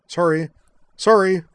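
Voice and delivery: loud, high, conciliatory apology; sheepish. A narrator's reading. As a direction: apologise quickly with a sheepish grin. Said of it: "with a sheepish, conciliatory grin".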